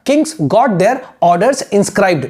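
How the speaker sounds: very slow, word by word; teacher dictating; slow dictation. Shown as a demonstration. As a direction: dictate slowly for writing. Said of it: "बस इतना लिखो".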